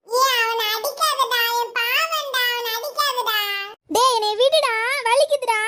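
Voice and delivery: put-on high, slow begging kid; pleading child. Kid begging cousin to stop hitting, high and pleading. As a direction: beg him to stop hitting.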